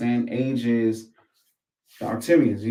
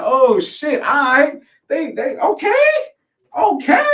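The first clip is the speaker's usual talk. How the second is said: high, loud rising "okay"; pitched-up, astonished exclamation; amazed reaction; 11 semitones higher, 4 dB louder, slower than usual. Retold or imitated. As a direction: react hyped and impressed, voice shooting up. Acting out own astonished reaction.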